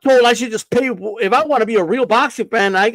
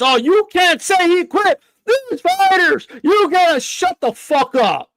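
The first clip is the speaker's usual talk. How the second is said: higher, shouted mock complaint; hammed-up angry commenter; mock outraged critic; 6 semitones higher than usual. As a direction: parody the offended fan yelling at him. Mocking critics of "quit.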